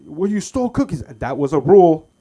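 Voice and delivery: high, loud accusing mom voice; mock mom scold. Mom accusing her son of stealing cookies, loud. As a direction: argue back as the mother.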